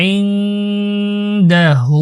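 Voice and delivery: ইনদাহু chanted slowly, the final hu held long; three alif stretch. Shown as a demonstration. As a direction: draw out the hu of ইনদাহু for three beats. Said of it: "এখানে তিন আলিফ টানতে হবে". (ইনদাহু-তে হু তিন আলিফ টানা)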